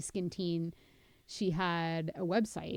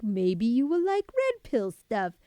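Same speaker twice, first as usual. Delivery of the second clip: mock algorithm voice; 5 semitones higher, 8 dB louder than usual. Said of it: "They're like".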